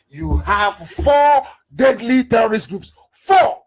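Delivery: slow, emphatic build to a shouted FOUR; outraged emphasis. Real, not acted. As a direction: hammer it out, then shout the number. Outraged rant over terror groups.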